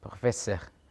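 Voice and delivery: Professor whispered with a throaty French R. Shown as a demonstration. Teacher demonstrating French R to students, whispered Professor. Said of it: "Kalau R, baca eh".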